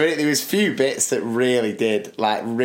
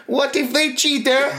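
much higher mock Borat accent; Borat impression; 13 semitones higher than usual. Borat questioning the dating agent, mock accent. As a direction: ham up a Borat worry. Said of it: "And then he goes like".

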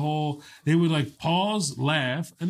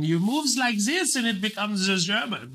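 higher, put-on German accent; 8 semitones higher than usual. Shown as a demonstration. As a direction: demonstrate a mock German accent. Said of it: "do the German accent".

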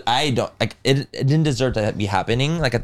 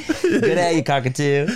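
attempted Australian accent; much slower than usual. Shown as a demonstration. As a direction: put on an unsure Australian accent. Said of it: "try to do an Australian accent".

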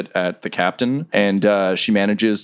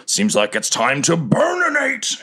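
high, hammed-up dragon warrior voice; mock-epic cry, climbing high at the end; dragonborn battle cry; 9 semitones higher than usual. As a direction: build into a theatrical threat. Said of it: "kinda looks around and says".

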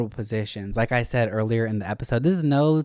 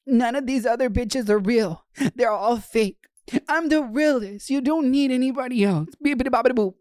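much higher, whiny mock pleading; whiny crying boyfriend; 13 semitones higher than usual. Mocking a crying bottom pleading with his top. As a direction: imitate a clingy boyfriend begging. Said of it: "was, like, crying to his top".

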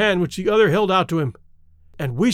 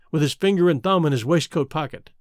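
ordinary delivery, nothing marked

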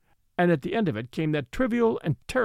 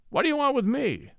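high, defiant outburst; an Irish officer confronting the detective, high and defiant; defiant cry; 8 semitones higher than usual. A narrator's reading. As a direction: hurl the question back, defiant. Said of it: "he cried".

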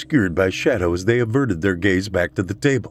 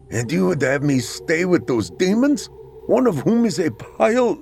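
panicked; 9 semitones higher than usual. A narrator's reading. Panicked man arguing.

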